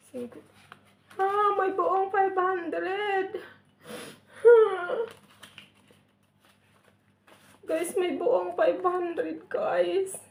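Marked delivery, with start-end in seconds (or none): 1.2-10.2 high and slow, voice close to crying; overwhelmed, nearly crying at the gift; teary surprise. Real, not acted. Finds five hundred, overwhelmed. (halos umiiyak sa tuwa, mataas at mabagal)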